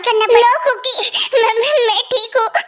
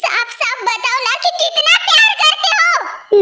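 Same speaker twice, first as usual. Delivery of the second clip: insistent demand; 6 semitones higher, slower than usual. Acted. Girlfriend insists on an answer.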